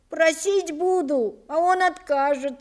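voiced boy's anxious query; doubtful boy. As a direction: raise the pitch and object timidly. Boy anxiously questions his master.

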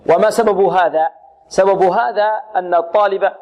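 normal talking voice

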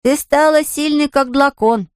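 put-on high, slow childlike voice; awed little boy. A narrator's reading. Gardar praises Anfisa's strength, high awed child voice. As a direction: voice a small child full of admiration.

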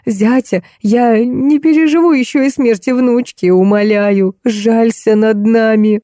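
narrator's restrained desperate plea; understated anguished old woman's appeal; crying out in despair. A narrator's reading. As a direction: beg for a life, voice strained but not shouting. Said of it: "схватила Светлану за плечи и закричала".

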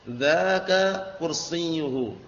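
Dzaka kursiyuhu spelled out slowly, word by word; slow model sentence. Shown as a demonstration. Teacher modelling Dzaka kursiyuhu slowly for the students. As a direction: say it slowly so the class can copy it. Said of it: "Ini berarti pakai dzaka".

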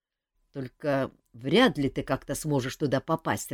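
usual speaking voice

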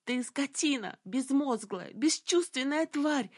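furious high scream. A narrator's reading. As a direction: yell the insults, voice high. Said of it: "орала".

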